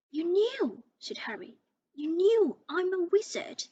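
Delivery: loud, high accusing cry; shocked accusation. Acted. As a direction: hurl it at them in disbelief. Harry confronts the Dursleys.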